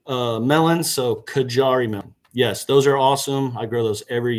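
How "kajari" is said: "Kajari" with a hard J as in jar. Shown as a demonstration. Gardener settling the Indian melon's name, "Kajari" with hard J. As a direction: say "Kajari" with a clear j sound, not h. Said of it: "like a, like a hard J".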